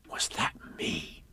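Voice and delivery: slow, hushed whisper; awed. Acted. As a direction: whisper it, hardly daring to believe. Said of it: "in an awed voice".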